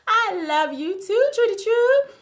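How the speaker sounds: high, loud, drawn-out singsong greeting; affectionate shout-out. Real, not acted. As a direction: call out your love, high and slow.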